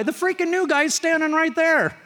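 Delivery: acted indignation. Preacher reacting to rude customer's insult of new kid, indignant. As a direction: raise pitch and volume in mock outrage.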